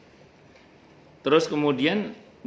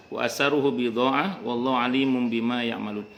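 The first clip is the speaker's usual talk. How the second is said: ordinary delivery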